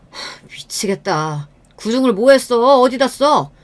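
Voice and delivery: scornful, rising outburst of frustration; exasperated husband. Acted. Husband scorns child's rank.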